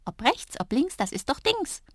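high, impish little voice; mischievous. A narrator's reading. Drups dodging the girl's question, high and mischievous. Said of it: "so richtig schelmisch gekichert und gesagt".

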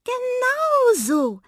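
very high, stretched, jubilant squeal; gleeful. A narrator's reading. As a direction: stretch it out in delight. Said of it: "lachte die Waldmaus". (hoch und gedehnt gejubelt)

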